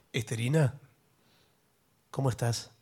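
woo her like a smooth leading man. Acted. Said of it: "esa voz de galán".

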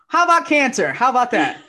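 loud, high laughing taunt; mock taunt. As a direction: throw it at yourself like a cruel joke. Universe taunt retold with laugh.

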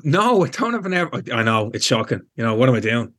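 ordinary talk

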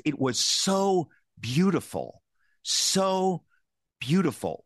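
SO drawn out and pitched high both times; emphatic. Retold or imitated. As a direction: quote dad marvelling, stretching a high SO. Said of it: "he says this emphatically twice".

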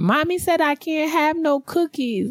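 put-on high, slow whiny child voice; little girl. Imitating the crying girl complaining about cookies, high and whiny. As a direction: mimic a pouting little girl.

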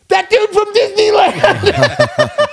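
high, loud laughing outburst of a sudden idea; excited outburst. Retold or imitated. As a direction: blurt it out like the answer just hit you.

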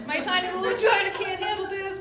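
high squeaky mock shock; shocked squeal. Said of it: "which I'm like".

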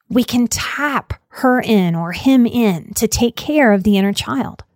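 normal talking voice

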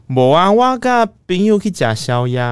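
high, slow, sing-song lie; a teen's over-innocent excuse to mom. Retold or imitated. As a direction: drag out the alibi sweetly. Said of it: "然后我就说".

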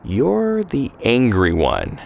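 said slowly and deliberately; a slow, knowing remark. Acted. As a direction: draw the words out, sizing her up. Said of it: "he said slowly".

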